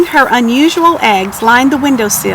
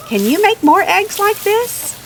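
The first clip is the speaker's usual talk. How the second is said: much higher, bubbling with delight; delighted question; 10 semitones higher than usual. Acted. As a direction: ask it like a farmer who can't believe her luck. Farmer delighted by unusual eggs.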